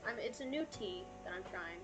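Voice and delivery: ordinary talk, nothing marked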